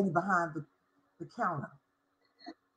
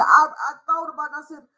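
usual speaking voice

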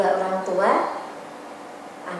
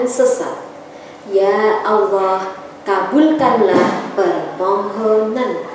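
measured, even classroom recitation; choral recitation; 6 dB louder than usual. Acted. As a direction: recite steadily like a class in unison. Recites translation in unison.

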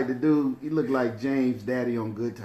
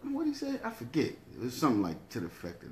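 usual speaking voice

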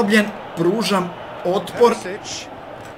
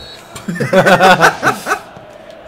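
laugh; 6 dB louder than usual. Real, not acted. Laughing at reply.

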